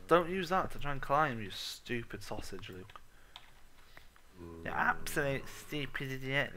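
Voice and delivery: ordinary delivery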